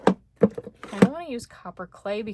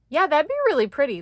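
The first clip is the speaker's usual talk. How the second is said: quick, loud, high burst of delight; sudden excited exclamation; excited gush; 6 semitones higher, 9 dB louder, faster than usual. Real, not acted. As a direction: blurt it out thrilled. Gushing over a card layout.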